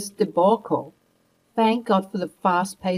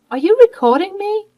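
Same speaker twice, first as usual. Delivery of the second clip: much higher, loud shocked question; alarmed disbelief; 14 semitones higher, 8 dB louder than usual. Acted. Grandmother realising Mia recorded her, shocked and high. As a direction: panic as it sinks in.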